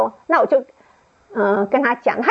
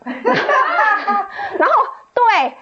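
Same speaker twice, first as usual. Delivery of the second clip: laughing; 11 semitones higher, much slower than usual. Real, not acted. 自嘲不想再讲.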